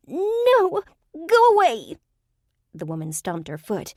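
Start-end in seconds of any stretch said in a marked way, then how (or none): 0.1-2.0 very high, loud squeal; high nasal shriek. A narrator's reading. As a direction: squeal in panic like a tiny creature. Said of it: "two octaves higher and painfully nasal".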